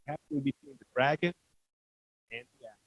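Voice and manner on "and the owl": ordinary delivery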